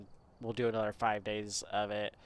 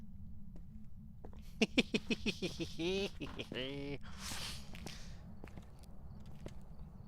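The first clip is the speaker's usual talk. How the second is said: soft breathless giggling; quiet wheezing laugh; 4 semitones higher, 9 dB quieter than usual. Real, not acted.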